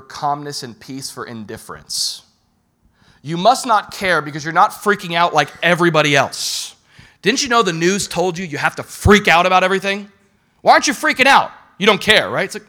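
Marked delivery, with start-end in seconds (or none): 3.2-12.3 louder, rising accusation; accusing voice. Retold or imitated. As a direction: hammer them with the accusation. Voicing people accusing calm believer.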